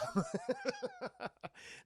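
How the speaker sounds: squeaky high-pitched giggle; high laugh. Real, not acted. Laugh after quoting.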